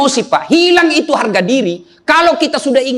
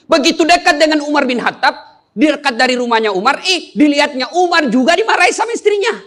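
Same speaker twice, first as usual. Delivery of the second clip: ordinary talk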